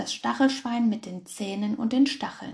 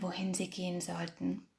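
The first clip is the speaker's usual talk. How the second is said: plain reading voice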